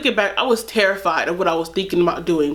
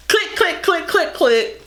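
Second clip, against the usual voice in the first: click noises; 8 semitones higher, slower than usual. Retold or imitated. Imitating hang-up.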